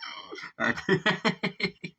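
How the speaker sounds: a real, high-pitched burst of laughter; delighted high laugh. Real, not acted. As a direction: crack up high. Laughing at the punchline.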